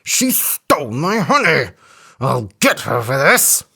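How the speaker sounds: an elephant vowing revenge; lightly voiced, grumbling elephant; cross elephant. A narrator's reading. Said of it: "said the elephant".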